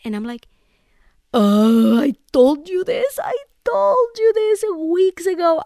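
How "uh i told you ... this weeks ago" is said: loud, higher, fed-up retort; an indignant burst, almost shouting; exasperated outburst. Retold or imitated. Wife to her panicking husband, loud and exasperated.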